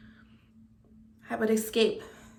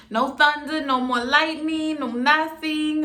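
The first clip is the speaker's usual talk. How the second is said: higher, louder, rejoicing; jubilant relief; 6 semitones higher, 8 dB louder than usual. Acted. Storyteller rejoicing that the forecast was clear, jubilant. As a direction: celebrate it loud and high, savoring each item.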